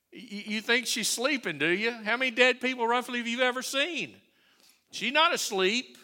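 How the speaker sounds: loud, high scornful jeering; loud sneering mockery; mocking mourners. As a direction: ridicule him as the crowd would. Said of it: "can you hear 'em making fun".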